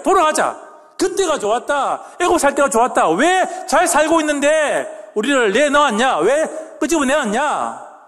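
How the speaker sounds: high, drawn-out protest; retold wailing complaint. Preacher voices Israelites longing for Egypt, wailing complaint. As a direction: imitate a crowd lamenting and demanding to go back. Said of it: "지금 그렇게 울고 있다는 것입니다".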